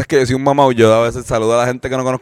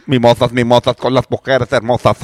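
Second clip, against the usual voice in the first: rapero de Zaragoza. Acted. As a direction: rap improvisado con acento zaragozano. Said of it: "el rapero de Zaragoza, por favor". (parodia rítmica de rapero zaragozano)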